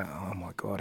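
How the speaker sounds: breathless gossipy whisper; hushed whisper. Retold or imitated. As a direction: lean in and whisper the gasp. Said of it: "they'd hear my accent and go".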